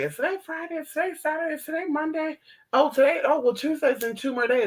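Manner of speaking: high, breathless mock confusion, lost track of days; confused self. Retelling her own mix-up about weekdays, mock confused high voice. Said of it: "so I was like".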